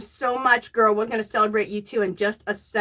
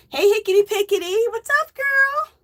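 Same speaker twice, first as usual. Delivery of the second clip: excited squeal; 12 semitones higher, 5 dB louder than usual. Real, not acted. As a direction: greet your best friend, delighted. Excited greeting of viewer.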